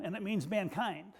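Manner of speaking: ordinary talk, nothing marked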